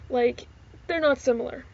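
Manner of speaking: ordinary talk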